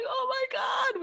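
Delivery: high, shouted oh my God; shocked gasp. Retold or imitated. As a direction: squeal it in disbelief. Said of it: "I was like".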